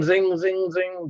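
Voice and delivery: high-pitched run of zings; zing through the curves; zinging sound effect. Shown as a demonstration. Mimicking the curves again.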